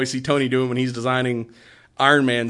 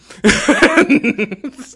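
loud high laugh; 12 semitones higher, 7 dB louder than usual. Real, not acted. Amused by Maria's put-down, loud high laugh.

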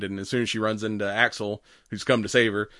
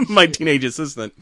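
mock angry confrontation; 5 semitones higher, 4 dB louder than usual. Imagined angry confrontation by wife.

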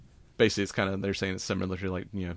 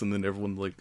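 ordinary talk

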